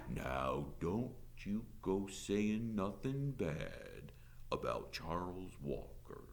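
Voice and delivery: soft, very slow Southern drawl; drawling sheriff. A narrator's reading. Sheriff cautioning the teacher about Walker, drawling. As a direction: warn her lazily, stretching every word. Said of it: "in a slow drawl".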